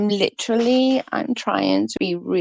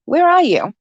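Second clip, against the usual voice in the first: calling out; 9 semitones higher, 5 dB louder than usual. Real, not acted. Calling out to Nav in chat.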